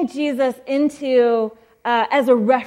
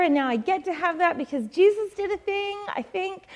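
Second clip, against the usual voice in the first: tearful laughing gush; 7 semitones higher than usual. Retold or imitated. Telling the church what her child self really felt, laughing through tears. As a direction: gush it out half laughing, half crying.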